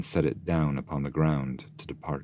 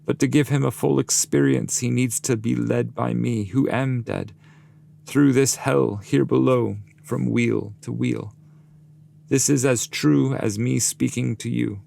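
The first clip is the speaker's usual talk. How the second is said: raised, grave guide's voice; solemn guide; 7 semitones higher, 4 dB louder than usual. Acted. Master explaining Dante to the damned, raised grave voice. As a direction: speak as the wise master answering firmly. Virgil's quoted speech continues.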